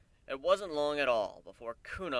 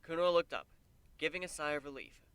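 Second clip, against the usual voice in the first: ordinary delivery, nothing marked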